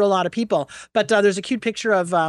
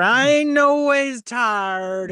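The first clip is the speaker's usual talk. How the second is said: slow, drawn-out mock Southern accent, pitched up; Hillary Clinton fake Southern accent; 5 semitones higher, much slower than usual. Mocking a politician's put-on Southern accent. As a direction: ham up a put-on Southern accent, dragging each word. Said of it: "she's pulling a Hillary Clinton".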